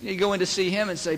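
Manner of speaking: ordinary talk, nothing marked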